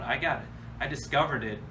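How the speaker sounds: usual speaking voice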